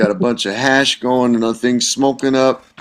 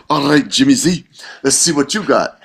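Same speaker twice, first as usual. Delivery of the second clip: lively imitation of a Scottish woman, slightly raised; Scottish-accented dare, pitched up; attempted Scottish accent; 4 semitones higher than usual. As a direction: challenge him in a rough Scottish accent. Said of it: "Scottish accent, but, but I'll just try".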